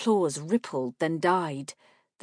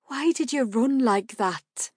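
worried woman; 6 semitones higher, 4 dB louder than usual. A narrator's reading. Marka asks worriedly.

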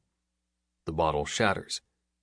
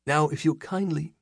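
mock polite villain; 9 semitones higher than usual. A narrator's reading. Skem mock-polite order.

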